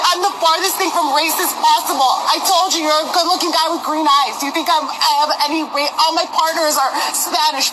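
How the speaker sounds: crying through the words, voice cracking high; begging while she sobs, barely holding it together; sobbing plea. Real, not acted. To her online critics, a sobbing desperate plea.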